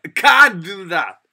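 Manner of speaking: loud, drawn-out CAN'T, falling after; stress on CAN'T. Shown as a demonstration. Teacher showing the negative sentence, stressed "can't". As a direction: lean long and hard on "can't". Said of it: "이렇게 하십니다".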